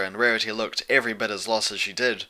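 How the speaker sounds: ordinary delivery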